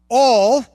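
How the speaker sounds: all said with a heavy, emphatic push; stress on all. Shown as a demonstration. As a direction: lean hard on the word all.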